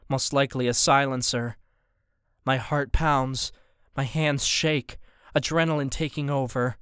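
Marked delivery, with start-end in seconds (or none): none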